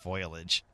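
"foliage" said quietly as FOY-lij; mock the error, "foliage" as FOY-lij; mispronounced foliage. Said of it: "Those people don't know what".

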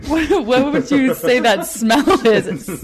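laughing too hard to ask straight. Real, not acted. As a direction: ask it while cracking up. Laughing at the raw heart's smell.

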